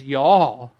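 "Y'all" punched loud and falling; emphatic y'all. Shown as a demonstration. Pastor stresses Jesus's plural you to the church, loud. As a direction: hit "Y'all" hard, it's emphatic. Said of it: "it's in the emphatic sense in Greek".

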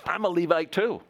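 a hint of an old film tough guy; Korah as Edward G. Robinson. Korah challenging Moses, a light Edward G. Robinson voice. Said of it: "Korah, Edward G. Robinson".

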